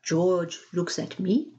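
plain reading voice

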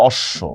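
অশ্ব as "osh-sho"; doubled consonant. Shown as a demonstration. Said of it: "দুইবার উচ্চারণ করতেছি".

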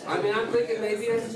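laughing. Real, not acted. Audience member laughing at the DiMaggio cell phone joke.

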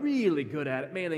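ordinary talk, nothing marked

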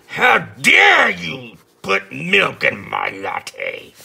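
slow, loud hammed-up monster shout; raging Hyde. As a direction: bellow it like an outraged villain. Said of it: "He's like".

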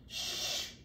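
whispered shh; hushing. Acted. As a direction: hush everyone, danger nearby. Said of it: "said Papa Bear".

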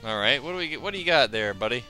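ordinary delivery

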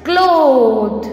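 "Cloth" drawn out on one long vowel; pronunciation demo. Shown as a demonstration. Teacher showing students how to pronounce it, drawn-out demo. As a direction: say "Cloth" slowly, stretching the o. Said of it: "How we will pronounce this?".

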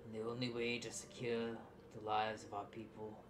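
halting confession with long pauses; remorseful father. Acted. Game master voices remorseful father explaining.

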